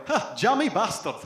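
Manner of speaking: envious Scottish bloke muttering; Scottish accent. Retold or imitated. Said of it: "made the men Scottish there".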